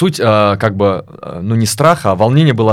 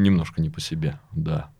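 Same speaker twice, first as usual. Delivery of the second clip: normal talking voice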